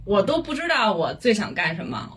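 ordinary talk

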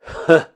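short scornful "hmph"; cold scoff. A narrator's reading. Master dismissing the threat of revenge, cold scoff. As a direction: scoff coldly through the nose. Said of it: "梁正春冷笑了一声".

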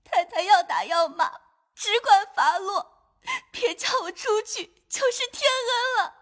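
sobbing, high, soft plea; high-pitched crying, sobs breaking between phrases; tearful pleading. Acted. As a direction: beg for mercy through tears. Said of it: "忙跪下哭道".

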